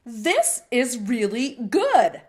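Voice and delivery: slow, emphatic praise from a professor. Retold or imitated. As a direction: drag out the praise, savouring it. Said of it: "He's like".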